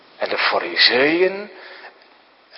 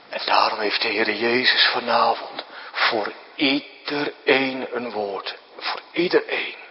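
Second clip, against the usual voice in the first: softer, almost a whisper; hushed; 4 semitones lower, 7 dB quieter than usual. Real, not acted. Preacher to the children at the end, hushed whisper. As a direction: lean in and say it gently.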